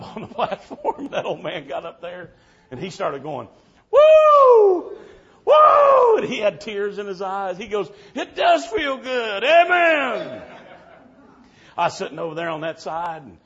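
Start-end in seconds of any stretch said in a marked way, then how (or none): 3.9-10.4 high loud whoops, then slow tearful praise; tearful joyful whoop. Retold or imitated. Old Granddaddy in heaven, whooping through tears. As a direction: whoop like an old man overjoyed. Old man weeping for joy.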